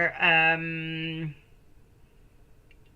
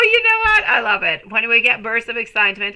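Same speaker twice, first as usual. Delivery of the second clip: ordinary talk, nothing marked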